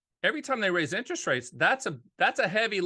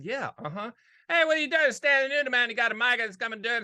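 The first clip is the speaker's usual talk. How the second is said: Uncle TW impression; 5 semitones higher than usual. Uncle on the phone about a maturing annuity, mumbled high voice. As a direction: rattle it off like the half-heard uncle. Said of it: "Like, in the back of his voice".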